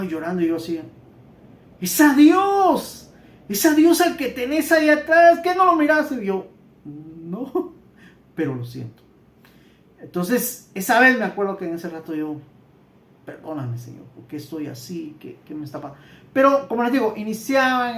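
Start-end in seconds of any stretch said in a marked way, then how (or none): 1.8-6.1 acted outburst, high-pitched and forceful; emphatic retold exclamation. Brother's emphatic reply in retold vision. 6.8-9.0 low, soft, subdued answer; small, hushed admission; meek retold reply. His meek answer to the outburst. 13.2-16.0 low, hushed plea to God; quiet, subdued pleading; retold humble prayer.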